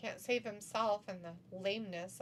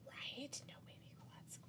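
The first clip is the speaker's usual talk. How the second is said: whispering; 4 semitones higher, 8 dB quieter than usual. Real, not acted. Sarcastic whispered joke.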